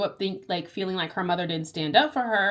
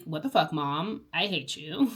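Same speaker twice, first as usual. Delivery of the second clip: ordinary talk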